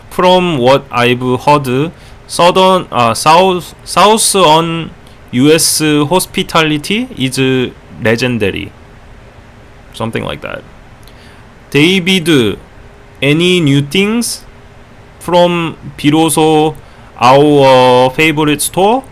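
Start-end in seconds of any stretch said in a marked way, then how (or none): 0.1-8.7 higher-pitched, halting Korean-accented English. Shown as a demonstration. Streamer reads a chat sentence on request, Korean accent. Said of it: "in Korean accent it would be". 11.7-19.0 higher, slow Korean-accented English. Shown as a demonstration. Streamer reads chat question to David, Korean accent. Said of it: "in Korean accent it would be".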